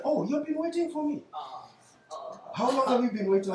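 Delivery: lightly voiced pastor, faking surprise; mock innocent pastor. Preacher mimics his pastor asking if he waited long. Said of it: "That's how he'll talk to me".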